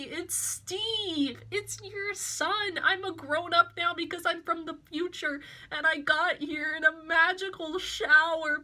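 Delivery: hammed-up son begging his mother; mock desperate plea. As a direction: beg through the window to Mom. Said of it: "he's like".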